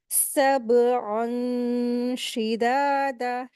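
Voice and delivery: "सबअन शिदादा" slowly, the noon hidden in the nose and held; ghunna recitation. Shown as a demonstration. Teacher showing students the nasal hiding rule, slow ghunna recitation. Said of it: "नाक में छुपा करके गुन्ना करके पढ़ेंगे".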